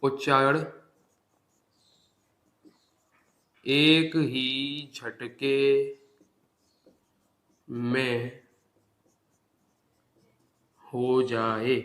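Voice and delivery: slow, spaced dictation for notes; dictating to students. Acted. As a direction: read it out word by word for writing down. Dictating definition slowly.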